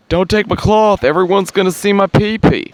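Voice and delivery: very high, loud hammed-up wail; high-pitched comic alarm; mock panicked man. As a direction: protest in a shrieky pretend panic. Voices man losing his cloth.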